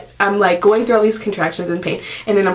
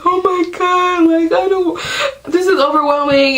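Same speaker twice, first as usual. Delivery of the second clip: overwhelmed; 10 semitones higher than usual. Retold or imitated. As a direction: replay her own overwhelmed panic. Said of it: "literally crying in this room".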